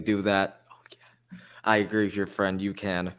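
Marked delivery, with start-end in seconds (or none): 0.7-1.4 whispered aside; hushed whisper. Real, not acted. Sudden whisper.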